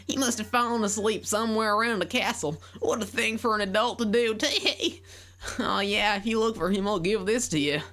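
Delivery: put-on very high girl voice with a country accent; country farm girl voice, high and sweet. Voicing Malon.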